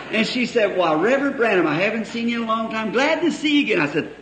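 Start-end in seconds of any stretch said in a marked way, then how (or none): 0.8-3.8 higher voice of the sweet wife; mock wife voice. Preacher voicing the wife greeting him, higher. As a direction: play the wife brightly, higher.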